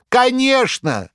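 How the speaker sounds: very high, loud slow sneer; sarcastic yell. A narrator's reading. Korovyev sneering to the crowd, loud sarcastic. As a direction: bellow it with a twisted mouth. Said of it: "саркастически кривя рот во весь голос орал".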